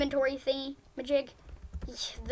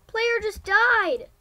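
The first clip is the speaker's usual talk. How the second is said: excited announcement; 5 semitones higher, 5 dB louder, slower than usual. Real, not acted. Announcing in-game death.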